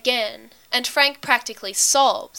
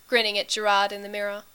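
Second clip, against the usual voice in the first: ordinary delivery, nothing marked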